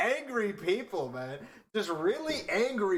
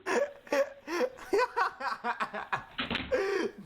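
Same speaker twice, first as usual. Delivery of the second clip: a long burst of real laughter, higher; 13 semitones higher than usual. Real, not acted. Streamer watching the interrogation clip, long laughing fit.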